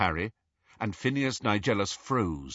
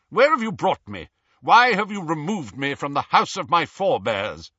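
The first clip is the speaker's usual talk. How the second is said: high, loud, affronted demand; indignant portrait headmaster; 8 semitones higher, 7 dB louder than usual. A narrator's reading. Blindfolded portrait demanding where he is, affronted. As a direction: demand answers in pompous outrage.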